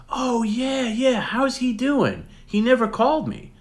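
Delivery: very high, slow, put-on celebrity voice; Mark Hamill impression. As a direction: pitch way up and stretch the words out. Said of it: "And Mark goes".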